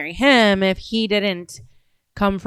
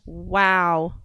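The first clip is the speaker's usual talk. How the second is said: ordinary delivery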